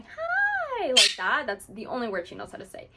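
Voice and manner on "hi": squeaky parrot-like high hi; imitating a parrot's tiny high hi; really high pitch. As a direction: pitch way up like a little bird. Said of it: "she'll do this really high pitch going".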